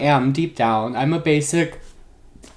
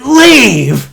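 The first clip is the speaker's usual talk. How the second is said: mock shout; 9 semitones higher, 11 dB louder than usual. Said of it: "He said".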